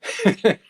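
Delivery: sharp high laugh; burst of laughter. Real, not acted. Laughing out loud at a viewer's message. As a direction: let out a sudden loud laugh.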